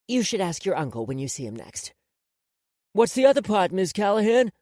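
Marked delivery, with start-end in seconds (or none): 2.9-4.5 put-on higher, louder kid's voice; eager boy. A narrator's reading. Boy among grinning friends asks the woman, eager. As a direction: pipe up to the teacher figure.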